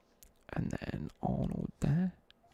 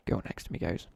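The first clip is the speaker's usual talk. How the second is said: ordinary delivery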